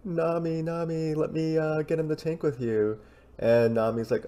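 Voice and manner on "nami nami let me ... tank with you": high, soft pleading voice; acted-out little plea to come along; pleading Sanji impression. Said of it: "he's like".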